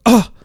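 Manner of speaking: short loud groan of pain; pained grunt. Acted. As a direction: grunt as you hit the ground. Character falls to ground.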